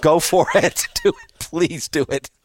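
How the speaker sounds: playful imitation, urging destruction; mock eager Jonah. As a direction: egg God on with mock glee. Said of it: "Jonah's attitude about God".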